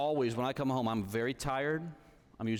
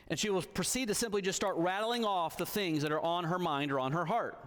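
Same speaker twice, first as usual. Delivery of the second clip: ordinary talk, nothing marked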